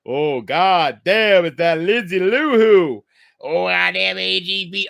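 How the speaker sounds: put-on slow, loud grumbling old man; hammed-up angry grandpa leering at Lindsay. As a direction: growl it like a cranky grandpa. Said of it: "my impression of angry grandpa".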